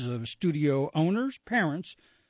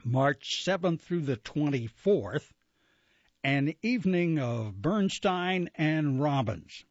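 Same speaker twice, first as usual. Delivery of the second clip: ordinary talk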